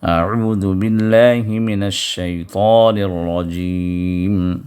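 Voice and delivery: drawn-out, measured tajweed chant; slow Quran recitation. Shown as a demonstration. Teacher reciting Surah Al-Asr for students, slow chant. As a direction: recite each word slowly and melodically.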